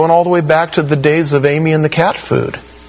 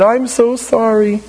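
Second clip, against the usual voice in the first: higher, drawn-out mock sob of sorry; mock tearful apology; 6 semitones higher than usual. Preacher mimics a weeping sinner, mock tearful. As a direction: act out a pitiful weepy sorry.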